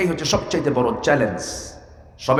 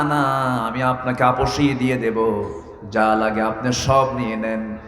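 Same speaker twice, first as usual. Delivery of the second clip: melodic sermon storytelling; 4 semitones lower than usual. A narrator's reading. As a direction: intone the tale in long sustained notes.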